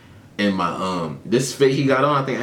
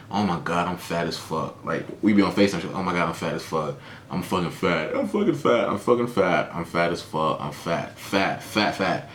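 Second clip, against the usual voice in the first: low, soft mock whine; mimicking a girl; 5 semitones lower, 5 dB quieter than usual. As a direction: sulk it out like a girl fishing for compliments. Imitating a girl complaining.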